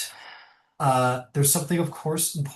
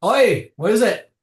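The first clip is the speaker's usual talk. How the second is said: gruff dwarf foreman; 7 semitones higher, 8 dB louder than usual. Acted. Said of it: "looks over and goes".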